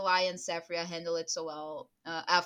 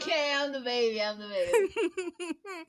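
laughing; 5 semitones higher, 9 dB louder than usual. Real, not acted. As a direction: give in while laughing. Laughing concession.

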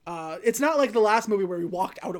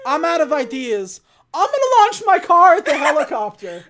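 mock shout; 7 semitones higher, 11 dB louder than usual. Dom's desperate plan retold.

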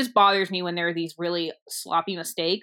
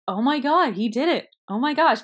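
amazed relief; 6 semitones higher, 4 dB louder than usual. Retold or imitated. Voices relief that he succeeded.

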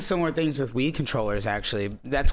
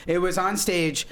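ordinary talk

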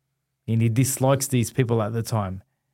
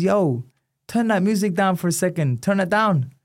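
higher, a hint of [name] giving an order; imitating [name]; 7 semitones higher than usual. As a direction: play the prince telling the gym to cut the music.

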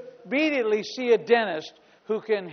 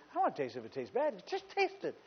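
raccoon voice; 15 dB quieter, faster than usual. Retold or imitated. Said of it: "He goes".

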